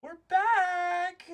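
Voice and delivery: high, loud burst; excited announcement. Real, not acted. Host announcing the return to viewers, excited. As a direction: burst back in.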